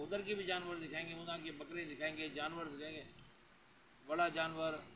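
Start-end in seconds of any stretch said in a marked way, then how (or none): none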